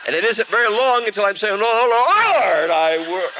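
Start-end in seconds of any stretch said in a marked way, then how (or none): 1.6-3.3 high, loud cry broken off; cut-off prayer. Acted. The goat butts the preacher mid-prayer, loud broken cry. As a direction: yelp the prayer as you get butted.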